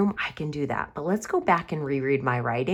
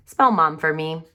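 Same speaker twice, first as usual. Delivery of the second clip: ordinary talk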